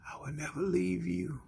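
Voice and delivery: soft whispered divine promise; still small voice. Acted. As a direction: breathe the promise gently, almost voiceless. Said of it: "that voice continues to say".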